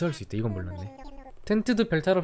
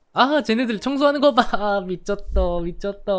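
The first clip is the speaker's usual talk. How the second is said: much higher, louder excited exclaiming; thrilled; 6 semitones higher, 7 dB louder than usual. Real, not acted. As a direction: burst out in delight. Thrilled by villagers cleaning.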